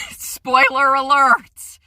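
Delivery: loud, high, drawn-out announcement; loud warning call. Real, not acted. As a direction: sing out the warning, stretching it. Calls out a spoiler warning.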